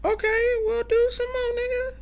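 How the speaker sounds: very high squeaky cartoon voice. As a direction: pipe it up like a cartoon. Squeaky playful put-on voice.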